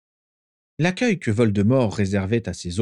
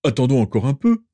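louder, lifted plea to wait; protesting; 5 semitones higher, 5 dB louder, faster than usual. A narrator's reading. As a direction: insist on waiting. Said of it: "protesta aussitôt Rodolphus".

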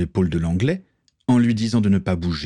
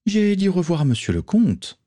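put-on higher, scornful young woman's voice; young pirate woman; 11 semitones higher than usual. Morgan scolds the count, scornful female voice. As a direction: talk down to the cowardly count.

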